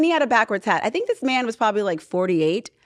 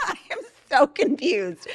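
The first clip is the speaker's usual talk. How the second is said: laughing; 4 semitones higher than usual. Real, not acted.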